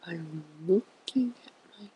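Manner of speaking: ordinary talk, nothing marked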